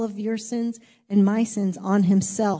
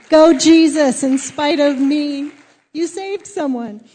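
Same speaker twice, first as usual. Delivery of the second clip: higher, loud cheer easing in volume; celebratory cheer; 5 semitones higher, 9 dB louder than usual. Acted. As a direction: cheer it out like a fan, then settle.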